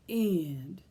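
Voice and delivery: plain reading voice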